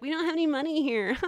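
high whiny rich guy. Retold or imitated. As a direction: whine it like a spoiled rich kid. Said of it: "I was making a very whiny male voice".

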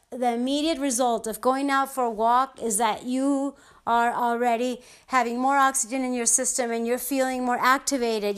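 usual speaking voice

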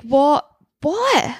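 can't believe what you just heard; real shocked disbelief; incredulous what. Real, not acted. Stunned by Neil's words.

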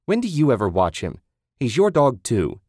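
yelling. Acted. Susan yelling at Ted.

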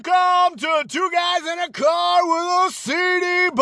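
booming arena announcer, high and slow; grand entrance announcement. Pretend. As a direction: announce it like a stadium host. Podcast intro announcer bombast.

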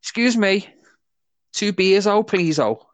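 high mock Spanish accent; mock Spanish tourist. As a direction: play a clueless tourist ordering beers. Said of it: "my attempt at being a Spanish person".

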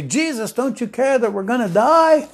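high, frantic cry of the frightened fishermen; a mild panicked shout from the disciples; panicked plea. Retold or imitated. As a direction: plead in alarm, voice jumping up high. Frightened fishermen wake Jesus.

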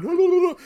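loud, high babbling mock war cry; rapid-fire high wail, played for effect; Raiden-style flying cry. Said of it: "flies Raiden style going".

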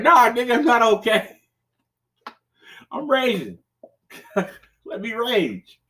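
hammed-up tantrum at the game; mock raging. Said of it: "I'm like".

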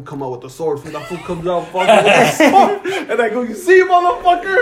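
high, loud laughing delivery. Real, not acted. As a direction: laugh through the punchline. Laughing through punchline.

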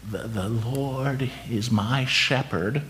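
put-on slow, soft, shaky elderly voice. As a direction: tremble through it quietly and slowly. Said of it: "began in a shaky voice".

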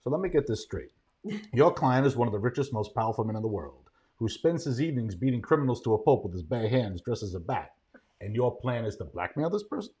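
quiet, low, dryly amused movie elder; Morgan Freeman. Acted. Executive answering a blackmailer, soft and unruffled. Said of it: "But Morgan Freeman".

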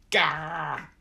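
high, loud battle grunt; tough-guy grunt. A narrator's reading. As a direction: grunt like a brawler. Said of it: "shouting and throwing punches".

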